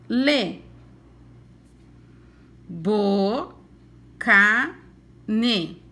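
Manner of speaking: "Ne, ta, lu" as separate, punched syllables; reading syllables one by one. Shown as a demonstration. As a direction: read each syllable card out with a beat. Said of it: "nós vamos ler as sílabas".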